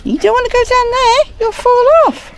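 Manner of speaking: high pet-talk voice; warning a pet. Real, not acted. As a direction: caution the dog in a high, quick voice. Warning dog away from edge.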